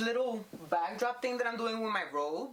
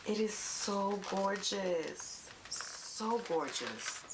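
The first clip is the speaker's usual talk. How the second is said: swooning; 2 semitones higher, much slower than usual. Real, not acted. Swooning over palette packaging.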